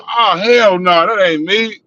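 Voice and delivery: slow, high, loud "hell no" in disbelief; shocked denial. Retold or imitated. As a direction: drag it out like you can't believe the accusation. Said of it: "I was like".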